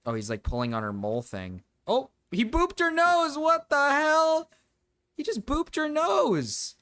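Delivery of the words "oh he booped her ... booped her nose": high squealing disbelief; high shrieking shock at the boop. Real, not acted. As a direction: squeal it out, stunned and slow. Shocked at monkey booping nose.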